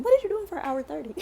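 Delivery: fast, laughing incredulous question; laughing disbelief. Retold or imitated. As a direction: ask it half laughing in disbelief. Incredulous at travel time.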